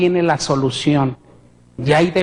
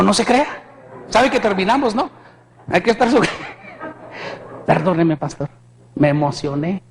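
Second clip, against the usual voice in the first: ordinary talk, nothing marked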